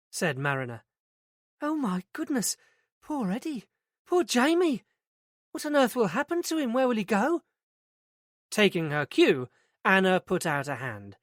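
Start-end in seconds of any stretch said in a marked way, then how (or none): 1.6-7.4 put-on higher, dismayed older woman's voice; shocked manager. A narrator's reading. Day centre manager hearing of Eddie's death, higher dismayed voice. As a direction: voice a flustered manager reeling at bad news. Manager hears client's father died.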